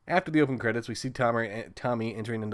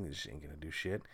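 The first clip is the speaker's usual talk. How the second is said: low, quiet growly monster mock; lightly voiced unbothered monster; Jason monster voice; 7 semitones lower, 12 dB quieter than usual. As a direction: drop into a menacing killer voice. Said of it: "He's like".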